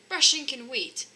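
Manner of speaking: husband rushing off to catch the voice; cried out. Acted. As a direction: call it out eagerly. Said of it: "cried Mister Forgetful".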